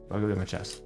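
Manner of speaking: ordinary talk, nothing marked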